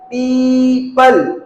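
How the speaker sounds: पीपल slowly, with a long held पी; drawn-out pronunciation. Shown as a demonstration. Teacher showing how we say it, slowly drawn out. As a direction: stretch पी, then land पल in पीपल. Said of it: "ऐसा बोलते हैं हम".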